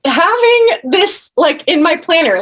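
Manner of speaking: slow, high, insistent inner voice; determined. Retold or imitated. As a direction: set your jaw and refuse to leave. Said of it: "I was like".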